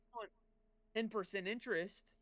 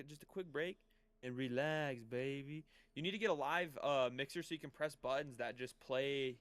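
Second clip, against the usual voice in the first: smooth radio host; 3 semitones lower than usual. Pretend. Late-night radio host bit.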